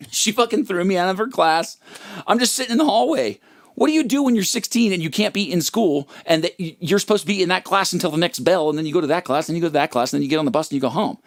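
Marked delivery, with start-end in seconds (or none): none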